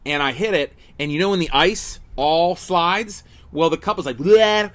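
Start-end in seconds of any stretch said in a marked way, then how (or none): 4.2-4.7 sudden loud, high "bleh" as the cup tips; imitated spill noise. Telling fans how the iced cup dumped on him, imitated spill noise. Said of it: "You like those sounds?".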